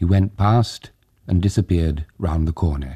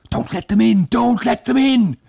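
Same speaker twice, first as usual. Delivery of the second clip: much higher, louder screaming plea; panicked cry; 6 dB louder than usual. Acted.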